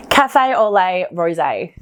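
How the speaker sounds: Café au lait rosé in a playful Australian accent, rosé as roh-zay. Shown as a demonstration. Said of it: "if you wanna go Australian accent".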